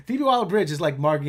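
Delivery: normal talking voice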